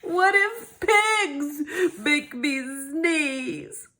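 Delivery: slow, worried question; dread. Acted. Gerald slowly fearing pigs make him sneeze. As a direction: drag it out in dread.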